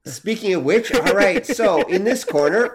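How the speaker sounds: loud, rising ring announcer hype; booming fighting game announcer. Pretend. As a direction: call out the fighters like a fight-night host. Announces a mock fight intro.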